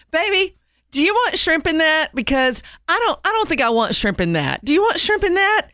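high sweet coaxing wife voice; sweet lady voice. Retold or imitated. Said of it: "I hear the lady say".

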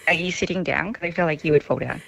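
normal talking voice

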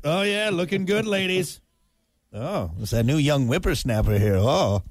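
a hint of a creaky old-timer; old prospector. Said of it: "You remind me of, like, Sal from Happy Gilmore".

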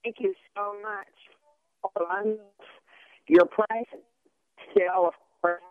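choppy cutting-out cell call; breaking up phone line. Real, not acted. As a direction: voice dropping out on a bad connection. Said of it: "You're breaking up".

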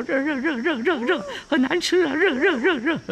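high, rapid yelps of a monkey scalding his hands; high squealing monkey voice, fingers burning; monkey burned by hot cake. Acted. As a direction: squeal it out as Wukong tossing the hot cake hand to hand. Wukong juggling a hot cake.

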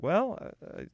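high, slow, hesitant doctor voice; baffled doctor. Acted. Doctor conceding to a recovered patient, high halting bafflement. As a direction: stammer reluctantly, pitched well up. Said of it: "the doctor's gonna be like".

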